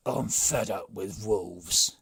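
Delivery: loud, slow grumbling chief; the brigand chief, fed up; slow loud complaint from the chief; gruff chief. A narrator's reading. Chief voiced in story.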